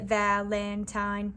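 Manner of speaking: slow, evenly split syllables; robot. Retold or imitated. Teacher showing how the class said Valentine, robotic. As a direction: say Valentine as three level robot beats. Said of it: "say this word like a robot".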